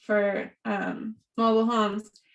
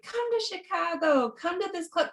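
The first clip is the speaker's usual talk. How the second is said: higher, sing-song invite to the club; coaxing friend; 8 semitones higher than usual. Retold or imitated. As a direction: lure her in with a high bright coax. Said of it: "my friend's like".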